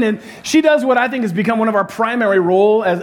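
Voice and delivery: ordinary talk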